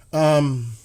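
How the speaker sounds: ordinary talk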